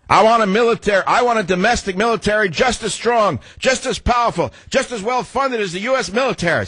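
high-pitched mock politician accent, chanting each demand; Obama. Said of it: "Same voice".

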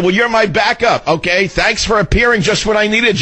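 high, delighted thanks; excited thanks. Retold or imitated. Host thanking his bar ally, high and delighted. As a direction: beam it out, grateful and thrilled. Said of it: "I said".

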